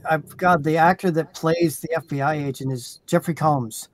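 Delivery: normal talking voice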